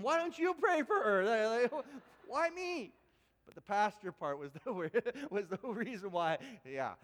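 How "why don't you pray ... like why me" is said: high, loud, indignant why me; panicked inner protest. Retold or imitated. Pastor recalls panicking when asked to pray, high and loud. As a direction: yelp it like you've been put on the spot. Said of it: "I'm thinking".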